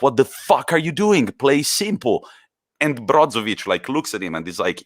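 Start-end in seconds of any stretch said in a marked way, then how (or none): 0.0-2.3 high, sharp telling-off; Conte yelling at his player. Retold or imitated. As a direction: snap at the player to keep it simple. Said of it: "he's like".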